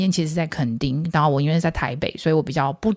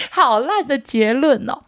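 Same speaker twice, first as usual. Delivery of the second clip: high laugh then slow groan at the joke; amused self-mockery; self-mocking laugh; 9 semitones higher, slower than usual. Real, not acted. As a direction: laugh at your own lame ending. Laughs at own bad conclusion.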